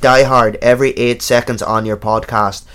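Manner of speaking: put-on Dublin accent. Shown as a demonstration. Co-host reading a listener's letter on request, Dublin accent. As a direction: read it out in broad Dublin. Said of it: "can you do, like, Dublin?".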